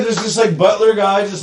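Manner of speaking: usual speaking voice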